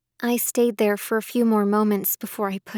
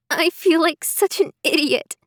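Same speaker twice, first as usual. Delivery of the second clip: tearful; 6 semitones higher than usual. Acted. Emily crying in Sarah's arms.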